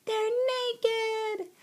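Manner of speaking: very high, gleeful squeal; giggly little girl. A narrator's reading. Girl shocks her sisters, giggly squeal. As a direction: squeal the naughty word high.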